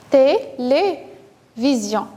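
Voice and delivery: slow "Télévision", té - lé - vision with pauses; split into syllables. Shown as a demonstration. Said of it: "le son L dans la deuxième syllabe". (« Télévision » lentement, syllabe par syllabe)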